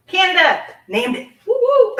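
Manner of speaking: high, loud whooping cheer; excited whoop with a laugh; celebratory cheer. Real, not acted. Cheers a correct guess of origin.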